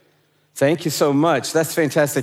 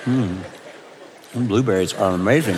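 mouth full; 10 semitones lower, 4 dB quieter than usual. Real, not acted. Eating on stage, laughing.

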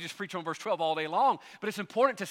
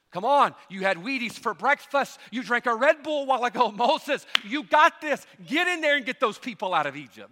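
hammed-up hype coach, higher and louder; mock pep talk; 4 semitones higher, 6 dB louder than usual. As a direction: pump Moses up like a locker-room coach. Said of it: "He didn't go".

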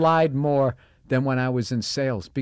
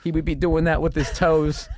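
ordinary delivery

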